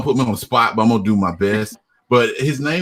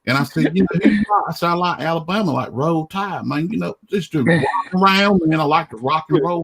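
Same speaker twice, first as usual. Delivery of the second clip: higher-pitched Georgia country accent; Southern accent; 6 semitones higher than usual. Shown as a demonstration. Guest jokes about Georgia and Alabama, Southern accent. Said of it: "start talking like this".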